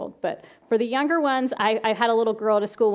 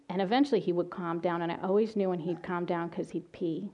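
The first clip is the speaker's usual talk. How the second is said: usual speaking voice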